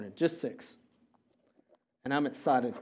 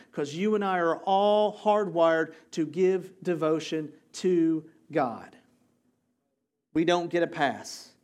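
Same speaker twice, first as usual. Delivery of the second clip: louder, driving sermon voice; preaching at full force; 3 semitones higher, 5 dB louder than usual. Real, not acted. Pastor urging church to devotion, full-force preaching. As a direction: preach it out to the whole room.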